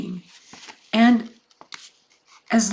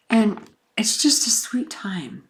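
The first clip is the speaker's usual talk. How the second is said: tender; 2 semitones higher than usual. Real, not acted. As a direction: linger on it fondly. Tender memory of visits.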